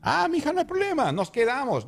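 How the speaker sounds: mother cheerfully inviting herself along; eager mother. Retold or imitated. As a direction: play the overjoyed mom who tags along. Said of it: "dice que dijo".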